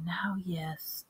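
breathy whisper to himself; whispered aside. A narrator's reading. Wandmaker muttering over his shelves, whispered. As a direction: murmur it under the breath.

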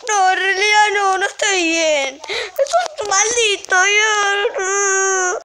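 slow, drawn-out pretend sobbing complaint; mock crying. Injured [name] complains about Ian, slow mock-crying whine. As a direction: whine slowly as if hurt and tearful.

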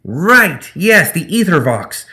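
high, loud, delighted gremlin; beaming gremlin. Acted. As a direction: preen with pleasure. Zip pleased.

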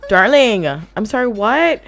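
loud, startled what; outraged at the game; shocked. Real, not acted. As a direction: blurt it out in disbelief. Shocked at sim.

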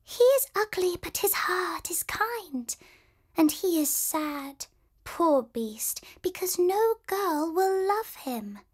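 high, light bird voices; the birds answer Belle in high twittering voices. A narrator's reading. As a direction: chirp it like little birds. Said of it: "the birds twittered back".

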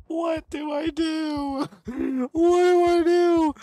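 high, slow crying wail; panicked crying. Real, not acted. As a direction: wail it in despair.

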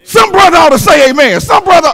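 high, full-force preacher shout; shouted appeal. Real, not acted. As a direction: call out loudly for an amen. Preacher calling congregation for amen.

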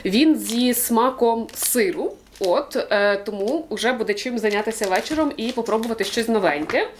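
ordinary talk

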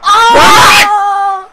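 loud, high yell; sudden drawn-out cry of shock; startled scream. Real, not acted. Player caught off guard in game, screaming.

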